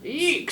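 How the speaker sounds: short high loud squeal; high shrill eek. Acted. Children see the giant teacher, high loud eek scream. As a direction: let out a frightened high eek. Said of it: "screamed the children".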